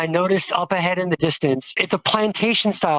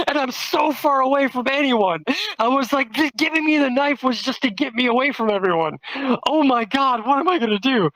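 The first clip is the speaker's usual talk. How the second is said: mock panic; 8 semitones higher than usual. Retelling terror in raised voice.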